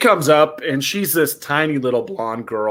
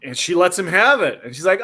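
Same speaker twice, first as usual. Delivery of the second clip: usual speaking voice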